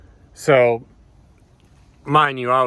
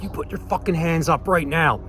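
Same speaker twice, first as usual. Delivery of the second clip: retold screaming; 6 semitones higher than usual. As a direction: act out yelling an order without full volume. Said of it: "So I'm screaming at him".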